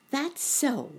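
high-pitched bunny exclamation; exclaiming. A narrator's reading. Boy bunny agreeing with his uncle, high exclamation. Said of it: "exclaimed Sammy".

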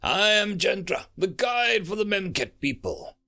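high, ringing proclamation; imperious shade. Acted. Guide of souls introduces herself to a human, imperious. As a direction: announce yourself grandly, pitched high.

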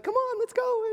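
high, breezy call to come along; breezy Elijah. Retold or imitated. Elijah leading the army off, breezy high call.

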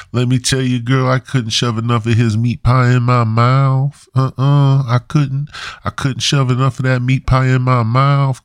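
high, sassy voice savoring every word; sassy gossiping girl. Pretend. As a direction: camp it up like a gossiping girlfriend. Sassy woman gossiping about meat pie.

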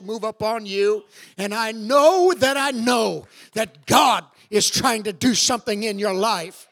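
loud fervent preaching. Real, not acted. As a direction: press it home to the church. Preaching at full force.

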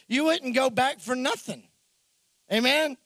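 ordinary talk

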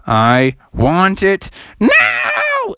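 a frog throwing a tantrum; demanding frog. Acted. Froggy demands.

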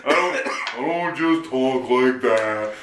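fattened-up voice. Retold or imitated. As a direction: puff up the voice to mock the impression. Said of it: "You really fatten up your voice".

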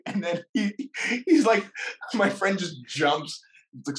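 ordinary delivery

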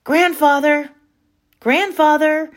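loud, high call across the farm. A narrator's reading. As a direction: call him from the roof.

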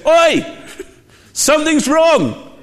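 sudden high, loud warning shout; high-pitched alarmed holler. Acted. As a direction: yell it out to get their attention. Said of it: "and he's shouting".

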